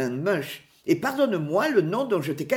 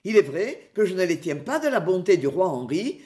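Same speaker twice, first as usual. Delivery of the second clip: ordinary delivery, nothing marked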